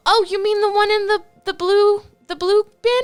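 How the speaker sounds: high, sweet motherly coaxing; a doting mom nudging her homebody son to go out, high and sweet. Acted. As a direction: coo at her grown son like a fussing mother. Ma Tucker answers about plants.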